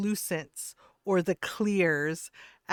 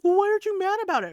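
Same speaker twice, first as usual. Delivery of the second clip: very high, loud, rushed squawk; mock a man in frantic disbelief; imitated spiraling out; 13 semitones higher, 10 dB louder, faster than usual. Streamer imitating man baffled she isn't mad, fast high squawk. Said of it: "The, the dude spiraled out".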